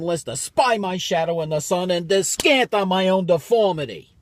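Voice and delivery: high, loud rant building to a furious peak; bitter soliloquy. Acted. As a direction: rail against your own deformity in stage verse. Soliloquy erupts over speaker's deformity.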